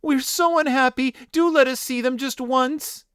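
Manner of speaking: very high unhappy plea; pleading. Acted. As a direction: beg desperately. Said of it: "they cried".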